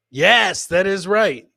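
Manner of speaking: usual speaking voice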